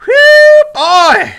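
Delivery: high loud whew; excited whoop. Real, not acted. Collector celebrating his pull, high and loud. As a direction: let the breath out in a thrilled holler. Celebrating another hit card.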